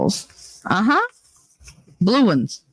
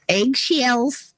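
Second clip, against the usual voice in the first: "Eggshells" said higher in a stray put-on accent; sudden odd accent; 7 semitones higher than usual. Shown as a demonstration. Streamer repeating her own word to chat, in a stray accent. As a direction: slip into an unplanned accent on "Eggshells". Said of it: "I don't know where that accent came from".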